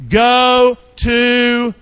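drawn-out, loud call; loud prompting. Real, not acted. Preacher cueing the congregation's answer, loud and drawn out. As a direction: shout it out, stretching each word.

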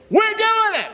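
high, loud rallying cry; leading the congregation in a shout; call-and-response shout. Real, not acted. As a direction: lift the church with it. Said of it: "Say it with me".

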